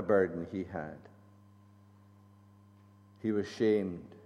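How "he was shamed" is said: normal talking voice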